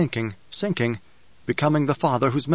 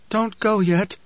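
rasping and uncertain; 7 semitones higher, slower than usual. A narrator's reading. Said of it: "rasping and uncertain".

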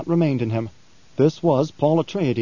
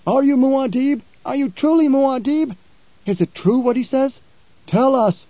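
much higher, louder pleading questions; clamoring crowd; 11 semitones higher, 4 dB louder than usual. Acted. Fremen crowding Paul, loud and pleading. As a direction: press him, demand an answer. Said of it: "showered with hoarse questions".

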